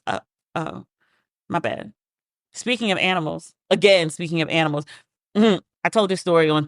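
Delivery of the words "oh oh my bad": sheepish admission. Retold or imitated. Sheepish admission to online crowd.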